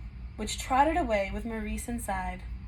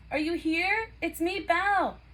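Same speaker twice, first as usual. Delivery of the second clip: raised, higher calling voice; 8 semitones higher, 3 dB louder than usual. A narrator's reading. Belle searching the castle for her father, calling out. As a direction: call into the empty halls. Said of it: "she called".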